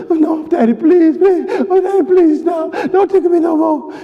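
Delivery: put-on high, frantic begging of a tickled kid; pleading child. Kids begging daddy to stop tickling, high playful pleading. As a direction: beg in a high-pitched child's squeal. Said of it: "they didn't just go".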